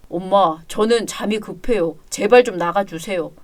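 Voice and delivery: low, soft groggy pleading; drowsy, low-energy grown son; sleepy son. Acted. Son too sleepy to get up.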